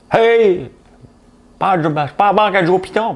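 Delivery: high, loud mock outburst; mock indignant protest. Retelling dad refusing the cheque, mock indignant protest. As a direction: protest in a squeaky high pitch, hammed up.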